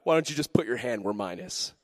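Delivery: casual, reassuring Jesus; gentle invitation. Acted. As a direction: invite them kindly, voice dropped. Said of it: "No, he says".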